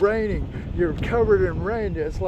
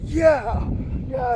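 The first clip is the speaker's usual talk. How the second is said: triumphant cheer; 6 semitones higher than usual. Retold or imitated. Runner cheering at the last two reps, triumphant. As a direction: re-enact a victory yell. Retelling his cheer at the top.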